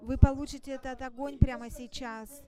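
loud, fervent altar call; fervent preaching. Acted. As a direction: proclaim it over the crowd.

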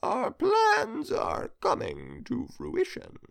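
put-on slow, high gravelly old ruler; smirking, creeping gravelly voice. A narrator's reading. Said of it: "the Emperor said with a gravelly voice and a smirk".

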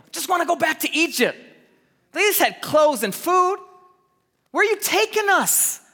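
high, loud whine of protest; mock complaining. Children of Israel protest the journey, mock whining.

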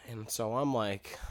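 ordinary talk

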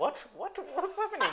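high-pitched mock confusion, half laughing; baffled action hero, hammed up; Tom Cruise. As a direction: squeak out the bewilderment through laughter.

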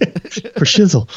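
slow, drawn-out [name] voice; [name] impression. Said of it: "we need [name] back".

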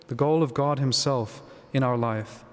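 normal talking voice

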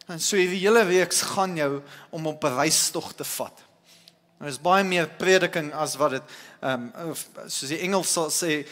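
ordinary delivery

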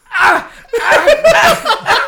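high, loud laughing pained yelps; mock pained cries. Son mimics dad walking hallway, high laughing pained yelps. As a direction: cry out with each painful step. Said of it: "And then he goes".

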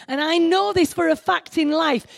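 ordinary talk, nothing marked

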